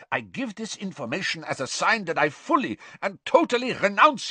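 terrified prisoner renouncing his master, high and loud; desperate pleading. A narrator's reading. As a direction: plead for mercy in rising panic. Karkaroff pleads in terror.

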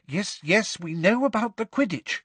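high, clipped-off impatience; tense. A narrator's reading. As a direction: push through, holding back irritation. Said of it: "in a tense voice".

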